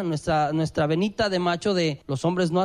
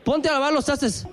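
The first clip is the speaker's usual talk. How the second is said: bossy demand; 5 semitones higher than usual. Shown as a demonstration. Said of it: "Tengo dañada la cosa con los trastes".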